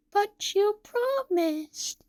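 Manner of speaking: high, slow pout; drawn-out wounded plea; Emu. A narrator's reading. Emu pleads with Tsukasa over his promise, pouting.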